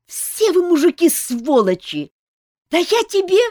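high, loud raging shout; enraged yelling. A narrator's reading. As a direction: rant at all men in fury. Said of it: "разъярилась Ольга".

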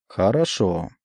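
usual speaking voice

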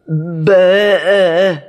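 bleating like a lamb. A narrator's reading. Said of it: "bêlant comme un agneau". (bêlement d'agneau)

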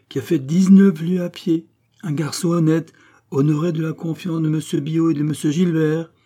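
high, forceful declamation to a crowd; voix de stentor. A narrator's reading. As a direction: proclaim your merits to the onlookers. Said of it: "d'une voix de stentor". (déclamation publique, haute et forte)

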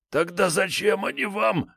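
high whiny frightened thug; a cornered petty crook pleading; scared thug voice. A narrator's reading.

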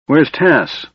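a lightly stern demand. A narrator's reading. Knight questioning the dwarf about the missing kender, a hint of sternness. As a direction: ask it firmly, mildly stern. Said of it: "the knight asked the dwarf sternly".

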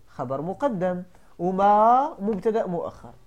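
ordinary talk

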